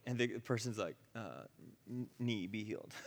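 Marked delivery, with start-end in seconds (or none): 1.1-2.9 hesitant, low and soft stammer; awkward. Retold or imitated. Sick person trying the teacher's command, awkward and unsure. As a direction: say it cluelessly, half under a nervous laugh. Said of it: "Like, super awkward, really strange".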